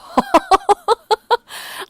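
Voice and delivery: loud embarrassed laugh. Real, not acted. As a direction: burst out laughing. Laugh after hitting the wall.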